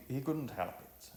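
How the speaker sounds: ordinary delivery, nothing marked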